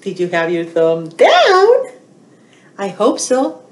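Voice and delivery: usual speaking voice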